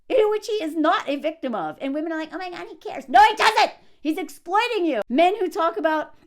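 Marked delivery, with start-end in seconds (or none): 2.3-3.1 softly gushing, imitated fan; hushed mock adoration; mock adoring fan. Mimics adoring women followers. 3.1-3.7 loud, high retort; sudden exasperated yell; exasperated correction. Real, not acted. Snaps back at mimicked fans.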